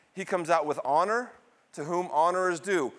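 usual speaking voice

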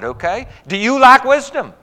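ordinary delivery

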